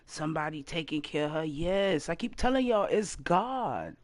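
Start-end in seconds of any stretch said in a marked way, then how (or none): 0.0-1.4 mocking gossip. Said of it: "motherfuckers is talking".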